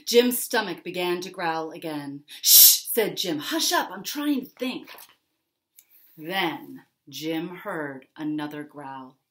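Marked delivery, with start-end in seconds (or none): 2.4-2.8 shushing. Acted. Jim hushes his stomach. 3.4-4.9 irritated hush. Acted. Jim scolds his stomach.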